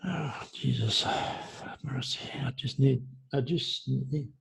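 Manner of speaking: real whispered plea, near tears; whispered prayer. Real, not acted. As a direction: pray under your breath, spent. Overwhelmed, praying under her breath.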